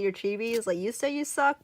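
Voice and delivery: ordinary talk, nothing marked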